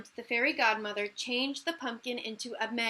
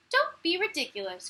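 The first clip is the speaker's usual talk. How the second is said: high, loud scoffing; scornful cry; 6 semitones higher, 5 dB louder than usual. Acted. Stepsisters scoffing at Cinderella, loud high scorn. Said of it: "cried the stepsisters".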